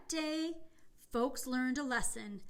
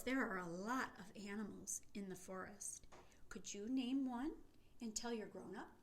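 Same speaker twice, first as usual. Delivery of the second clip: whispering; 2 semitones lower, 8 dB quieter than usual. Real, not acted. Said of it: "whispers to children".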